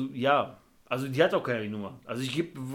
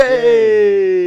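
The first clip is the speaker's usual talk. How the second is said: crowd echo; 5 semitones higher, 12 dB louder than usual. Pretend. Echo call of name.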